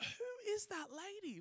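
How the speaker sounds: high, hushed, drawn-out amazement; amazed. Retold or imitated. Man failing to recognize the church lady, amazed. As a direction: stretch it out softly in high-pitched disbelief.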